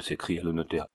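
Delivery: plain reading voice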